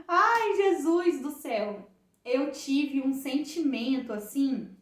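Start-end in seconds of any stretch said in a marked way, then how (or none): 0.1-1.9 loud, high, drawn-out exclamation; clamor rindo e chorando. Retold or imitated. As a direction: cry out to Jesus, overwhelmed and slow. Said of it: "comecei a rir, a rir, a chorar". (exclamação alta e arrastada, imitando emoção)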